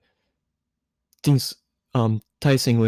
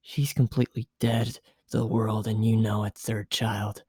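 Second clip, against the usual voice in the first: hushed teasing; 6 dB quieter than usual. A narrator's reading. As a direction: murmur close to his ear. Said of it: "Asuka whispering seductively".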